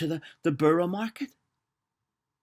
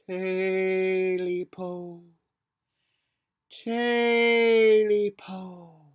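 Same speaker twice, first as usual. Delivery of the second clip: very slow wailing call; creepy creature cry; 5 dB louder, much slower than usual. Acted. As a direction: moan it like a ghost. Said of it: "a voice cry out".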